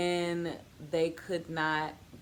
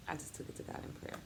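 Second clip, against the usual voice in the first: soft unvoiced whisper, a touch fast; whispered echo; 11 dB quieter, much faster than usual. Shown as a demonstration. Mother repeating her prayer line as a hushed whisper. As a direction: breathe it again as a private prayer.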